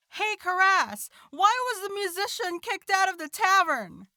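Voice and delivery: milk the joke setup like a heckler. Acted. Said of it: "I'm going to be like".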